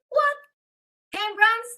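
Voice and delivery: high-pitched stunned questioning; shocked disbelief. Retold or imitated. Businesswoman told her brands are stopping, shocked. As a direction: squeak it out in retold shock. Acts out losing her clients.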